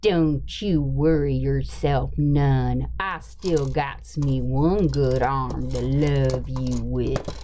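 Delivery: a heavier Alabama twang on the movie line; deep Southern accent. Shown as a demonstration. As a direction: push the Appalachian accent further south, slow and low. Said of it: "You need to go Alabama".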